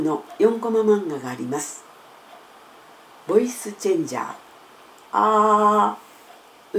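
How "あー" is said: sustained ah through a voice changer; alien voice. Shown as a demonstration. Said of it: "宇宙人みたいな声になる".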